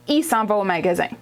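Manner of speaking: 'il' said as 'ee' with no L; Quebec contracted il. Shown as a demonstration. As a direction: drop the L so 'il' is just 'ee'.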